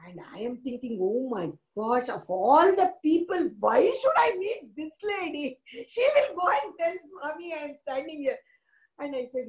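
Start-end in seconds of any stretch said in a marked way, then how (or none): 1.0-8.4 high-pitched, mock-horrified thought; dismayed inner outcry. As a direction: replay your dismay in a raised voice. Dismayed thought on being recognised.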